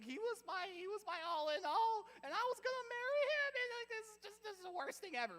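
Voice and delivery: high, loud hammed-up wailing; mock tearful meltdown. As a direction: play a heartbroken girl melodramatically in tears. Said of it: "now she's in tears".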